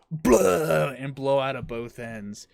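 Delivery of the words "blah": loud imitated retch; loud "blah" imitating throwing up; mock vomiting noise. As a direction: heave out a big fake puke sound. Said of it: "and I just go".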